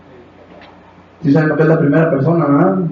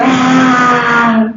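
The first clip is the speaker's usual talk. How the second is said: long, high mock crowd yell; imitated war cry; 7 semitones higher than usual. Preacher imitates everyone answering the leader, drawn-out mock war cry. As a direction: hold out a mocking "aah" yell. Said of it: "y todos dicen".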